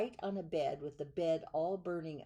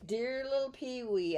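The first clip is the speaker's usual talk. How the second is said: higher, proud presenting; proud showman; 6 semitones higher, 4 dB louder than usual. Acted. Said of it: "and the circus man would say".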